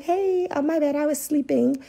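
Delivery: a hint of a drowsy babysitter excusing herself; sleepy babysitter. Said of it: "She was like".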